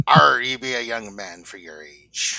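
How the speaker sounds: slow, growled-out pirate talk; old sea-dog pirate drawl. Acted. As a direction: draw it out like a salty pirate. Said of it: "answer in pirate voices".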